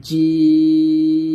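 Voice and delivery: "জিম" loud and very long, mid-tongue on palate. Shown as a demonstration. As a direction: hold out "জিম" loudly from the palate. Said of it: "জিহ্বার মধ্যখান উপর তালুর সঙ্গে".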